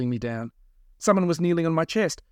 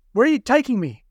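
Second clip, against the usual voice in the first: high, louder, anxious demand; raised pitch, urgent question; alarmed captive; 10 semitones higher, 7 dB louder than usual. A narrator's reading. Captive to his kidnappers in the van, alarmed.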